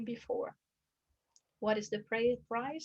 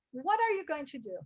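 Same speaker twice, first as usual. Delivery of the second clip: normal talking voice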